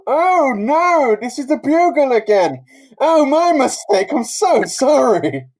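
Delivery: high, loud, slow fake embarrassment; flustered apology. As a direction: gush apologies like a caught-out villain. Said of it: "he's just like".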